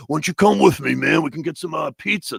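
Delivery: showy come-on in a performer's persona; in character. Retold or imitated. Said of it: "I'm in character".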